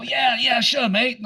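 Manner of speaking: high, easy Australian agreement; Australian locals' accent. Retold or imitated. Locals answering his beer order, in an Australian accent. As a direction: answer like a relaxed Aussie barman. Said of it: "And they go".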